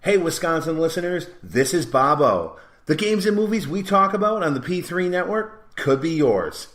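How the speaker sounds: upbeat radio commercial voice; ad read announcer. Acted. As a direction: sell it like a local radio spot.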